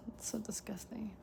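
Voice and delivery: hushed playful disgust; mock-disgusted whisper. Real, not acted. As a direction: whisper it like a guilty joke. Joking reaction to pulled card.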